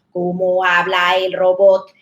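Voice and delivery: slow mechanical robot voice. Shown as a demonstration. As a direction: drone it out like a robot. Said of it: "cambios de código o cambios de entonación".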